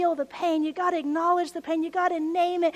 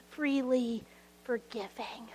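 breathy whispered aside, slowed; soft whisper; 5 semitones lower, 10 dB quieter, slower than usual. Real, not acted. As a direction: whisper it slowly like a secret.

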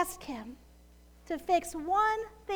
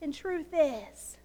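ordinary talk

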